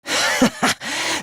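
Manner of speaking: short, high maniacal laugh; villainous laugh. Acted. Villain gloating over his new power, maniacal laugh.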